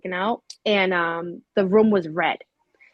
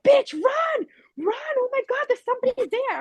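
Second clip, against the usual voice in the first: much higher, shrieking warning to flee; panicked cousin. Retold or imitated. Cousin on FaceTime urging her to run, shrieking. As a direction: scream it like you are watching a ghost find her.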